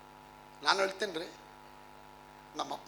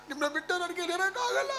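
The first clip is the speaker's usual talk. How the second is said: mock tearful wife; 12 semitones higher than usual. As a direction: playfully imitate a wife crying at parting. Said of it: "ಅಳ್ತಾಳೆ". (ಅಳುವ ಹೆಂಡತಿಯ ತಮಾಷೆಯ ಅನುಕರಣೆ)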